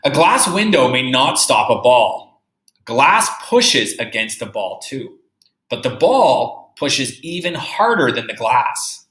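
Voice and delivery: slow, careful read-aloud; reading a picture book to a class; reading to children. Acted. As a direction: read the book slowly for young kids.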